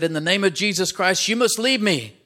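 high, loud forceful rebuke; loud rebuke "In the name of Jesus Christ" hitting every word; commanding rebuke. Acted. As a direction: drive the demons out with your voice. Man rebukes the demons.

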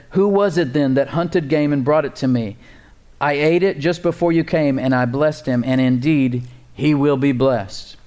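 ordinary talk

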